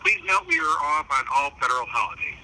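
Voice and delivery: ordinary talk, nothing marked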